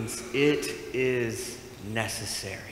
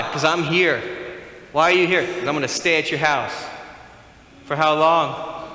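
loud, raised-pitch back-and-forth; insistent exchange; 5 semitones higher, 7 dB louder than usual. Acted. Uninvited guest and host arguing at the door, loud and insistent. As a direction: act out a pushy guest and startled host.

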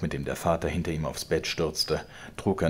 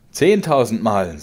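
höhnisch überlaut und langsam; spöttisch; 6 semitones higher, 12 dB louder, slower than usual. A narrator's reading. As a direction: den Vater verlachen, doch todernst. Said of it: "um den Vater zu verlachen". (Georg verspottet den Vater, laut und gedehnt)

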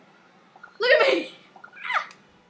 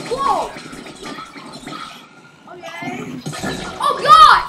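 startled shout; 10 dB louder, much slower than usual. Real, not acted. Player startled by a sudden attack in the game. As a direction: yelp twice in shock.

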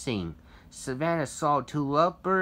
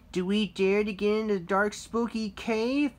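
nervous; 8 semitones higher, 5 dB louder than usual. A narrator's reading. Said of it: "gulped Annie nervously".

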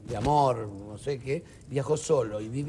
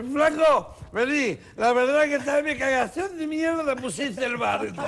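put-on high, louder drunk, half laughing; drunk punchline; 8 semitones higher, 4 dB louder than usual. Drunk on driftwood calling to the swimming magician. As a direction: toss the punchline like a drunk clinging to wreckage. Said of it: "le dice".

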